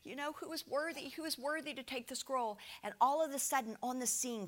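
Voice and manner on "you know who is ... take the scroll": quiet, mildly plaintive imitation of weepers; mock crying. Preacher voices the weeping onlookers in heaven, softly mock crying. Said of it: "and they're crying. They're like".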